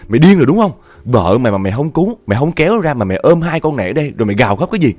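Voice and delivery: angry scolding. A narrator's reading. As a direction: lay into him, outraged and disbelieving. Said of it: "chú chửi quá trời luôn". (quát mắng giận dữ)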